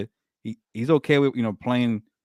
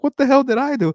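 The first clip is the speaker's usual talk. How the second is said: high, loud outraged cry; bewildered and worked up, louder and higher; fired up; 13 semitones higher, 5 dB louder than usual. Real, not acted. Host venting about angry DMs, fired-up high cry. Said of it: "I'm getting so fired up".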